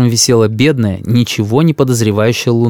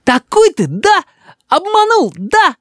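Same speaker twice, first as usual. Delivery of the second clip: put-on very high, indignant child voice; accusing little boy; slower than usual. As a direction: confront grandpa like a betrayed kid. Boy confronts grandfather angrily.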